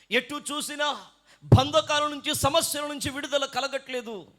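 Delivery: high, projected complaint; preached lament. Acted. Preacher voices a believer bound by problems, high and forceful.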